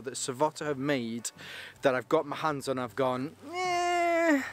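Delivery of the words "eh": unimpressed. Retold or imitated. Mock unimpressed reaction.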